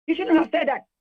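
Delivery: high and loud, a scolding reproach; indignant rebuke. Real, not acted. Union leader scolds the professor's comments, loud and indignant. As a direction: tell him off for what he said.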